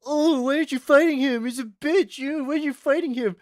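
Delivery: high, loud mimicking of nagging classmates; mocking classmates. Mocking the year level that hyped the rival, high voice. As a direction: parody their taunts.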